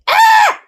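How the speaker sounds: sharp, very high scream of pain. A narrator's reading. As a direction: cry out as the bite lands. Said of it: "Mendoza screamed".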